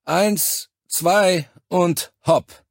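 high, slow count ending loud; called-out lifting count. A narrator's reading. Soldier counting comrades into lifting the carrier, called out. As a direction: count the crew into a heave.

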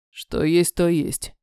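low, resigned man's voice; major's voice. A narrator's reading. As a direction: agree flatly, low. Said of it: "кивнул он". (низкий мужской голос)

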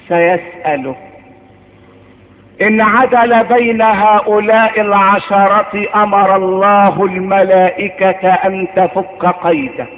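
ordinary delivery

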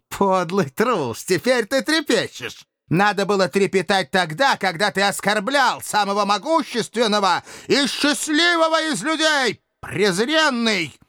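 high-pitched contemptuous fury; blazing anger. A narrator's reading. Dictator berates the trembling coward, blazing with anger. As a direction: lash out at the coward in a high, enraged voice. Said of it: "пылая гневом".

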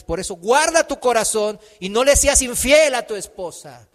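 loud preacher's scripture reading; forceful exhortation. Real, not acted. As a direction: press the command home, loudly.

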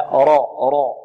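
راء with a full, dark, rounded r; emphatic heavy راء. Shown as a demonstration. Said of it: "مفخمة".

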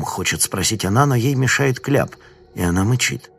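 plain reading voice

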